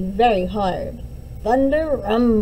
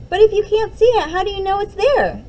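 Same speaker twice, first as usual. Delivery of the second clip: put-on high, loud, quick child's challenge; doubtful little girl; 9 semitones higher, 7 dB louder, faster than usual. Liza challenges Mom about the unseen string, high and eager. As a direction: push back like a skeptical kid.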